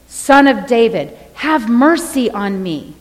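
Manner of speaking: crying out for mercy, moderately loud. Retold or imitated. Blind beggar calling to Jesus from the roadside, crying out. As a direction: plead loudly, drawing out the words. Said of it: "he cried out".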